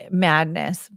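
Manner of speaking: ordinary talk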